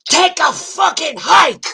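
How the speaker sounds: high, slow full-force shout; yelled punchline. Retold or imitated. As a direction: scream it as a woman telling off a drunk. Acts out Norma telling off heckler.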